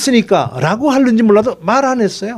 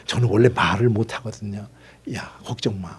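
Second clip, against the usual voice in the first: hushed retelling; 12 semitones lower, 9 dB quieter than usual. Retold or imitated. Moses and God pleading exchange.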